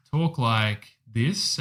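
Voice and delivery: very slow, drawn-out words; slow child-actor delivery. Shown as a demonstration. Showing a friend how old-film child leads talk, slow and deliberate. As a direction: stretch each word like a directed child actor. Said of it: "they talk kinda really slow".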